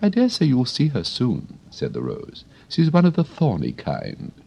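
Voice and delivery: high, prim flower voice; a proper lady of the garden; prim Rose. Acted. The Rose chats to Alice, high and prim.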